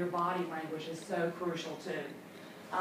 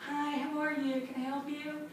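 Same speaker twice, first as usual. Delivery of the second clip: higher, softening desk greeting; mock seated customer greeting; perfunctory greeting; 5 semitones higher, 4 dB quieter than usual. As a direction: imitate a routine receptionist hello. Acted seated desk greeting, not standing.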